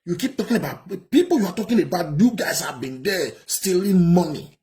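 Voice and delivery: indignant. Real, not acted. Commentator mocks the word struggle.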